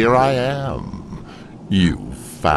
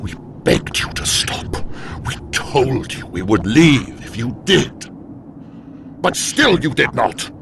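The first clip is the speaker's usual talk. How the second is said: hushed rasp swelling into higher outrage; anguished accusation; 4 semitones higher than usual. Acted. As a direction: build from pained rasp to bitter reproach. Demon recounts ignored pleas.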